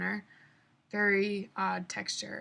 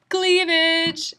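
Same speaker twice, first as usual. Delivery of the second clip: posing announcement; 9 semitones higher, 11 dB louder than usual. Retold or imitated. Friend posing for photo.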